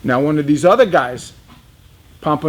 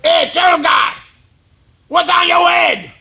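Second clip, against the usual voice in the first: Lauderdale's shout; 12 semitones higher than usual. Retold or imitated. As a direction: call out the question way up high. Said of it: "Lauderdale looks at me and goes".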